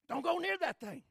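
high, loud mock warning to the ladies; alarmed warning. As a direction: shout the warning like a panicked brother.

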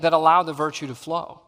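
normal talking voice

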